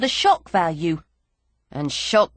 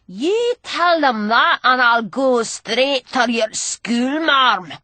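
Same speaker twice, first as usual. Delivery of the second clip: put-on sneering old drunk; 3 semitones higher, 3 dB louder, slower than usual. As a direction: taunt her like a grimy old rogue. Gully's voice.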